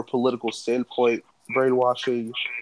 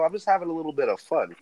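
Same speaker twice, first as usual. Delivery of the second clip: ordinary talk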